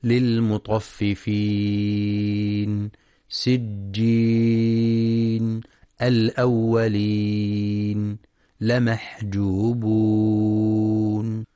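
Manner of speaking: verse endings held very long with the pausal madd; tajweed madd demo. Shown as a demonstration. Teacher demonstrates the long pausal madd at verse ends. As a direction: stretch the last vowel of each word, six counts. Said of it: "ورد المد العارض للسكون".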